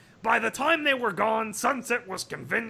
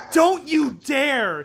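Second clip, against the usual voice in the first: higher, louder, slow furious cry; screeching; 6 semitones higher, 6 dB louder, slower than usual. Acted. Sunset to the photographer, slow shrieked warning. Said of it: "Sunset screeched".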